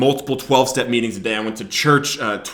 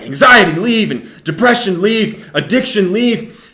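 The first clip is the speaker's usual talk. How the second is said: higher, louder preacher commanding; commanding prayer; 7 semitones higher, 7 dB louder, slower than usual. Retold or imitated. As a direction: order each thing out with authority. Elder praying, commanding spirits to leave.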